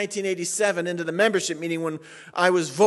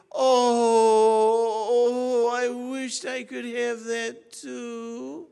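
mock sob; 5 semitones higher, much slower than usual. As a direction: fake a wail of envy. Mock wail of envy.

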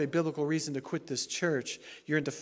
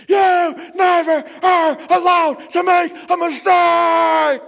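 yelling, much higher and louder, slow; furious yell; 18 dB louder, much slower than usual. Retold or imitated. Angry former elder screaming at the pastor over the phone. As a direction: roar it at him like a wounded elder. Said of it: "what he yelled back at me".

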